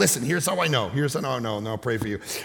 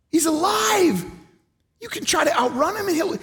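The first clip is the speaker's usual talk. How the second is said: full-force preaching; 12 semitones higher than usual. Acted. Preacher proclaims a living God to the church, shouting. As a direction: preach it out to the back row.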